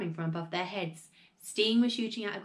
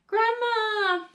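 high, loud drawn-out name; piercing, sustained cry; panicked shriek; 13 semitones higher, 7 dB louder than usual. A narrator's reading. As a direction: shriek to her in terror. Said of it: "shrieked Missus Cranky".

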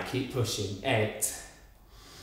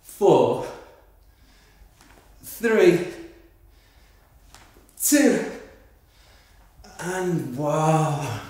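building count; 3 semitones higher, 4 dB louder, much slower than usual. Real, not acted. Coach counting down rising.

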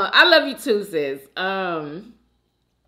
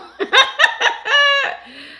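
high, loud burst of laughter; 6 dB louder than usual. Real, not acted. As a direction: laugh out at the memory. Recalling borrowed clothes.

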